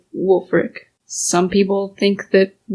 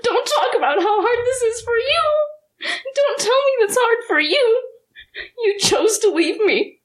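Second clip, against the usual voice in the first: tearful, hurt; 13 semitones higher than usual. Acted. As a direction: voice high and breaking with tears. Daughter confronts lost father.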